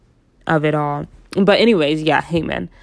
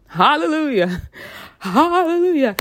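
preacher voice; 10 semitones higher than usual. Pretend. Preacher style praise.

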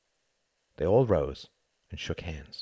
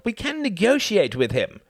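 much higher and louder, urging; high, raised plea to the cabinet; eager pleading; 7 dB louder than usual. Acted. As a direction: push the idea hopefully. Reginald pleads at the meeting.

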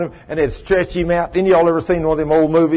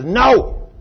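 higher, emphatic no; harsh refusal; 6 semitones higher, 3 dB louder than usual. Acted. Creditor turning down the begging debtor, harsh refusal. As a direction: refuse the plea outright. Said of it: "And the guy says".

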